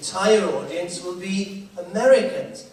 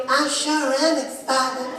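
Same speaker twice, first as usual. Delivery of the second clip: slow, higher mock-earnest tourist; gushing American spouse; 8 semitones higher, slower than usual. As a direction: savour each word like a thrilled tourist. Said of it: "I'm just so excited".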